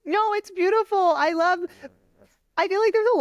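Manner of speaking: high, choked-up reassurance; moved to tears, reassuring her; teary. Real, not acted. Host moved by the guest's love story, choked up. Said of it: "Sorry, I'm, like, getting emotional".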